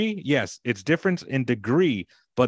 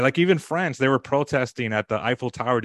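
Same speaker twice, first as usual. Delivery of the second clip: usual speaking voice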